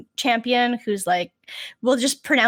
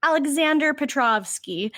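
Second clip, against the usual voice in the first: "Alexander Petrovsky" with flat American vowels; American pronunciation. Retold or imitated. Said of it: "She's being extra American".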